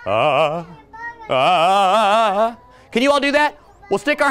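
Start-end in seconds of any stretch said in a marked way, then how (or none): 0.1-2.6 a short, strained ah, like wobbling on a wheel; teetering unicycle ah; wobbly balancing ah. Shown as a demonstration. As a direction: call out a shaky balancing ah. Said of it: "the balancing sound".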